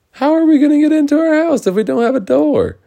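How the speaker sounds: very high, loud, fast outburst; panicked question. Acted. Builders locked out of their own fort, high fast panicked voice. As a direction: squeal it in comic alarm. Alarm at fort without door.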